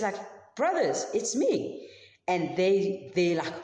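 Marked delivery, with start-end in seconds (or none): 0.6-1.9 brothers, it's me, voiced higher with joy; a bright, lifted reveal to his brothers; excited reveal. Joseph reveals himself to his brothers.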